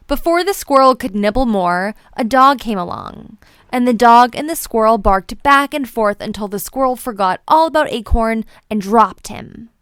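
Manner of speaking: usual speaking voice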